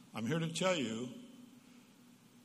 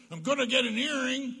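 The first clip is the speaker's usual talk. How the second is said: put-on much higher, louder defiant teen voice; teen announcing; 11 semitones higher, 6 dB louder than usual. Fifteen-year-old son at dinner, defiant. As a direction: toss it out to provoke. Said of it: "he yells out".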